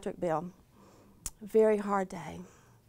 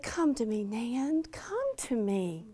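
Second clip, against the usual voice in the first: soft sweet whisper; 8 dB quieter, slower than usual. Retold or imitated. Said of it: "I heard that sweet whisper".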